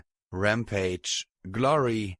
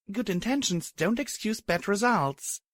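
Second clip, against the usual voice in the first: sighing, higher heroine voice; resigned sigh of regret; 11 semitones higher than usual. A narrator's reading. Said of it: "I said with a sigh".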